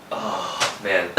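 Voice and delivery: ordinary talk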